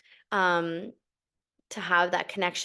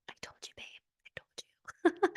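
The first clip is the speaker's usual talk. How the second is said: teasing whisper; 11 semitones higher, 9 dB quieter than usual. Retold or imitated. Whispering to husband, smug teasing whisper. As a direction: breathe it smugly.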